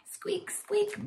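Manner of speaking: tiny very high-pitched squeaks; mouse squeak. A narrator's reading. Storyteller voices the mouse for preschoolers, high squeaks. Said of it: "the mouse went squeak".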